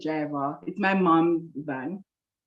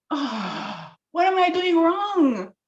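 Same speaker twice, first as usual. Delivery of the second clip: high, drawn-out mock wail; annoyed with God; 11 semitones higher, much slower than usual. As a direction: wail it slowly in exasperation. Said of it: "I was annoyed with God".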